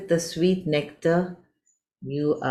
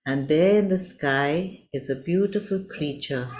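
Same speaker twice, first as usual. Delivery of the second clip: ordinary delivery, nothing marked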